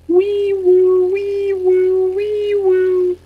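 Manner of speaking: high steady wee woo siren; police siren impression. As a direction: wail it like a cop car. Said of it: "That my impression of a police car".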